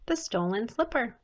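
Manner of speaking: plain reading voice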